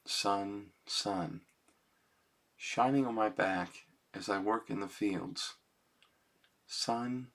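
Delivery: soft, measured reading of his own lyrics; quiet poem recital. Acted. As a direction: read it like a simple poem.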